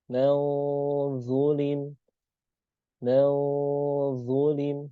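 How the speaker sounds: malzulim with the nasal melted into a heavy, thick z, slow and drawn out; tebal ikhfa. Shown as a demonstration. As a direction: say malzulim slowly, jaw dropped for a full, heavy sound. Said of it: "bukan mazulim". (malzulim dibaca tebal, pelan dan panjang)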